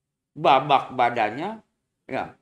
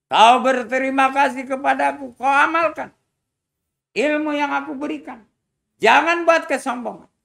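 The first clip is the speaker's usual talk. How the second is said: put-on higher, louder voice of an old teacher admonishing his pupil; teacher sending off his pupil, stern and raised; 10 semitones higher, 7 dB louder than usual. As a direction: lecture the student from above, firm and raised.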